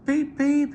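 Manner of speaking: high, loud whistle call; whistle beep. A narrator's reading. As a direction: pipe it out like an engine whistle. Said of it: "he whistled".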